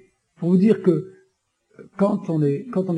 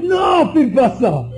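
high, loud, very slow commanding voice; God thundering a firm no; God's command; 6 semitones higher, 5 dB louder, much slower than usual. Retold or imitated. God forbids him to use violence, loud and slow. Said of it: "pourquoi Dieu m'a dit".

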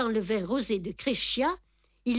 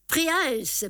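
Prince said much higher, in rapture; intoxicated delight; 8 semitones higher than usual. A narrator's reading. Tartarin delights in his princely friend, high and giddy. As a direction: savour the title with giddy joy. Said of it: "répétait-il avec ivresse".

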